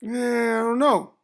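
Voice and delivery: slow, indifferent shrug of a reply; unexcited. Shown as a demonstration. Talking about the opportunity without excitement, unexcited. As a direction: sound like it barely matters to you. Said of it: "I don't seem that excited".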